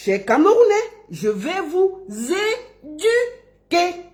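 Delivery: high, very slow "é-du-quer" in "éduquer"; mock classroom model of "éduquer", syllable by syllable; drawn-out, rounded "du" in "éduquer"; correct pronunciation. Shown as a demonstration. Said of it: "En bon français".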